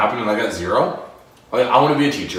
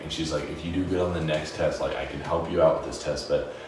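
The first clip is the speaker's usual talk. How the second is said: soft teacher voice; 6 semitones lower, 8 dB quieter than usual. Retold or imitated. Teacher gentle private talk.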